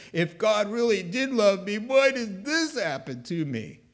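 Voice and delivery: hammed-up aggrieved outburst; indignant complaint. Mimicking someone blaming God, indignant.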